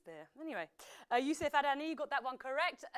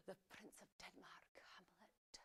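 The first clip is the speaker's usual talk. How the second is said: low, breath-only stage whisper; dramatic whisper; 6 semitones lower, 21 dB quieter than usual. Acted. Quiz host naming Hamlet, dramatic whisper. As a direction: whisper the title as a grand reveal.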